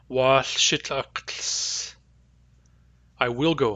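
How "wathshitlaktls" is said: Wathshitlaktls spelt out very slowly, each sound kept apart; slow careful pronunciation. Shown as a demonstration. Teacher modelling the future form for students, slow and careful. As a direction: draw out Wathshitlaktls sound by sound, lambda clear before akt. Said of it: "yet another sentence".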